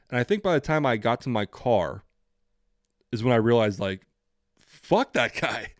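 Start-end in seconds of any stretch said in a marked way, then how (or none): 4.6-5.7 slow, emphatic dismissal; drawn-out disgust. Retold or imitated. Applicant reaching the car after a bad interview, slow disgust. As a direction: stretch every word in retold realisation. Retells his realisation at the car.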